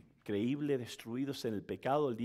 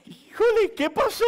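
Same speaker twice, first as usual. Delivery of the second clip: much higher, loud, still laughing; 12 dB louder than usual. Real, not acted. Preacher recovering from laughter, laughing loudly. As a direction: squeak it out laughing.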